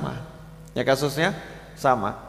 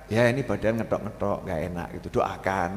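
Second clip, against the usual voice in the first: fading soft and feeble; weak sick voice; 8 semitones lower, 8 dB quieter, faster than usual. Acted. Sick person telling a visitor how they feel, weak and soft. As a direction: sound drained by illness.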